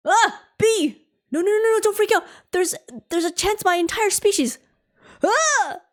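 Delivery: panicked screaming. Acted. Pilots panic at a bee.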